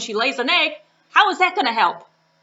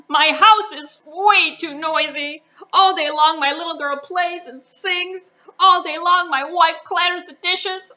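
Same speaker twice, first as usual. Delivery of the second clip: louder, higher calling across to the frog; man calls out his complaint to the wise frog, loud; 6 semitones higher, 6 dB louder than usual. Acted. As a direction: call it out to be heard. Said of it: "he calls out to the frog".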